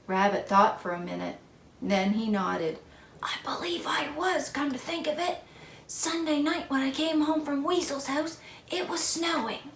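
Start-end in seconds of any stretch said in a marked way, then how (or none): 3.2-9.7 high hushed sore throat voice. Acted. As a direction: keep the sore throat hush. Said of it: "I have a very sore throat".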